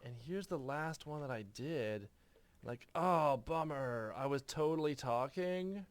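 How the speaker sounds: skater surfer voice. Acted. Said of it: "it's too skatery anyway".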